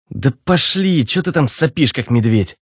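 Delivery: irritated hiss. A narrator's reading. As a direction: snap at him to get moving, starting high. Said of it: "зашипел он". (раздражённо шипя)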